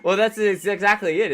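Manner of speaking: ordinary talk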